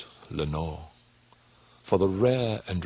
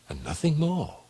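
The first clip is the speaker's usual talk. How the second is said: much higher, strained; nervous reassurance; 11 semitones higher than usual. Acted. Telling himself it's just the wind, high and strained. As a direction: talk yourself out of fear.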